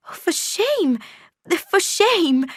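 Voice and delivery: high, falling scandalised cry; shocked maid. A narrator's reading. Maid scolding Jane, high shocked cry. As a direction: cry shame at the girl. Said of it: "cried Abbott".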